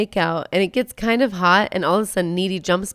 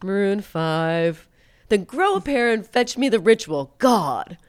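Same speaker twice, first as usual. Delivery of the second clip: mock disdainful bandmate; 3 semitones higher, 3 dB louder, slower than usual. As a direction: sneer at him like a snobby band guy. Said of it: "the other guy's like".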